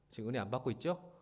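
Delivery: ordinary delivery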